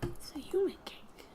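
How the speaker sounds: hushed and secretive, like sharing a secret; conspiratorial whisper. Pretend. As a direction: whisper it like forbidden knowledge. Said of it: "whispered secret about humans".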